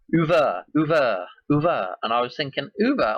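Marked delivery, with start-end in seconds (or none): 0.1-2.0 put-on crappy French accent, dropping the H. Retelling how he played with the word one night, French accent. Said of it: "a French accent. I was going like". 2.8-3.2 put-on higher-pitched, crappy French accent. Thinking aloud about what it sounds like, French accent. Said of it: "crappy French accent".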